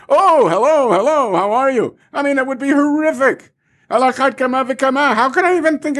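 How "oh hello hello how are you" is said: cheery reply. Retold or imitated. Mock cheery reply to friend.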